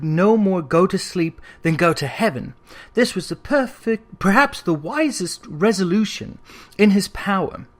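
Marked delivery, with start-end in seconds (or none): none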